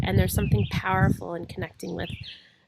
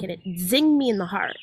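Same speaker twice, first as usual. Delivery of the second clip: ordinary talk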